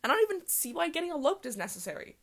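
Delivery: ordinary talk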